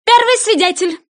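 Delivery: high, loud summons; loud call. Acted. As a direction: call the first witness across the court. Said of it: "громко позвал".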